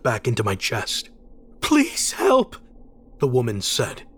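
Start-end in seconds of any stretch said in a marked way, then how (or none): 1.6-2.6 very high, distressed woman's voice; frantic plea. A narrator's reading. Distraught woman begging a driver for help, frantic. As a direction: beg desperately in a high pitch.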